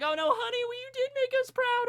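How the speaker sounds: very high, emotional parent voice; consoling parent. Acted. Parents reassure crying Uraraka, high emotional consoling. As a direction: console your child in a squeaky, overwrought voice.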